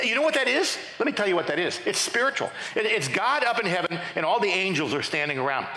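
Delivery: ordinary delivery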